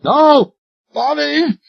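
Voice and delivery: high, drawn-out desperate cry; almost scream the denial; near scream. Acted. Royal desperately denies to Talbot, near screaming. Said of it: "His voice was almost a scream".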